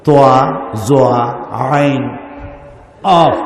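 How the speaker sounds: deliberate, separated Arabic letters; spaced-out letter-by-letter demonstration; Arabic letter names. Shown as a demonstration. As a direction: recite each letter on its own. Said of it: "উচ্চারণ সঠিক হবে না".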